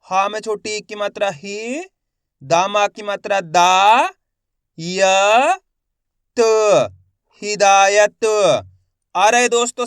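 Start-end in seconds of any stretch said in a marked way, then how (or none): 0.1-8.2 teacher's measured drill ending on a loud हिदायत; letter-by-letter sounding out. Shown as a demonstration. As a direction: spell it out syllable by syllable, stretching दा. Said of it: "एक ही अक्षर की पहचान करो, उनका उच्चारण करो".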